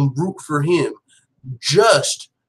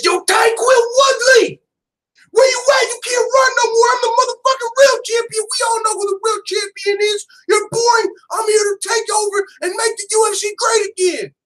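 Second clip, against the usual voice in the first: mock Kobe mic callout; 4 dB louder than usual. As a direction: ham up a fighter calling out the champ on the mic. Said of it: "gets on the mic and says".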